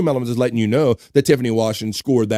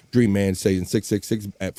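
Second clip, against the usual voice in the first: ordinary talk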